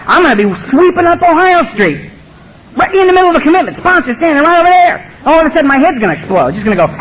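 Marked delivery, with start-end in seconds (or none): 0.1-5.0 raised pitch, urgent racing thought; worked-up, high-pitched inner outburst; agitated retold thought. Alcoholic imagining relapse mid-commitment, agitated.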